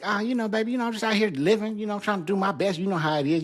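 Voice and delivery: breezy act. Retold or imitated. To his new therapist, a breezy high carefree front. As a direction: put on a carefree act, all is good. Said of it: "And I was like".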